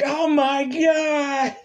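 high, slow, stretched-out cry of pain; agonized cry. Retold or imitated. David clutching his head as he transforms, pained cry. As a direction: wail it as the change grips you. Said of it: "He's like".

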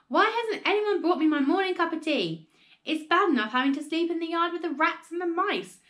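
loud, aggrieved complaint that eases toward the end; indignant shout. A narrator's reading. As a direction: storm at the household as an outraged grandma. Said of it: "she shouted".

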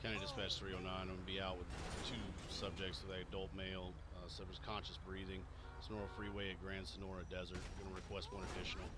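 quiet radio report. Acted. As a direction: murmur it like a deputy on the radio. Radio dispatch call.

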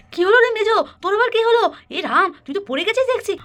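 high, loud startled questions; alarmed exclamation. Acted. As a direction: cry out on finding someone fallen. Alarmed reaction to a fall.

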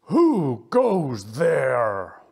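very slow, booming cheetah demand; stern challenge. Acted. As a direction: draw out a commanding challenge. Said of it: "a stern voice interrupted him".

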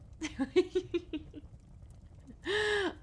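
high-pitched giggle; giggling. Acted.

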